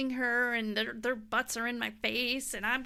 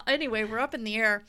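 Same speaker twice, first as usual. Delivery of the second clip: normal talking voice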